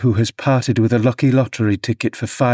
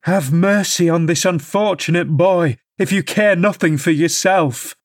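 earnest entreaty; 6 semitones higher than usual. Acted. Nurse begging Hindley to have mercy on his son, pleading. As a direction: plead for the boy's sake. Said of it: "I entreated".

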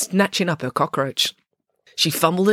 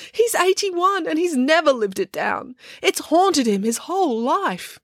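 put-on very high wailing girl's voice; 13 semitones higher than usual. A narrator's reading. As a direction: wail each line, pitched high. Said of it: "wailed Matilda".

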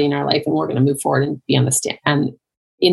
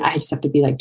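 usual speaking voice

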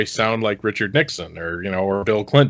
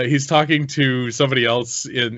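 ordinary talk, nothing marked

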